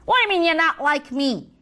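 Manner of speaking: normal talking voice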